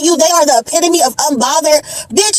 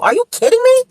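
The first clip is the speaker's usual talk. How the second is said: high-pitched, indignant disbelief; incredulous; 10 semitones higher than usual. Real, not acted. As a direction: pitch leaps up in outraged disbelief. Disbelief at her hypocrisy.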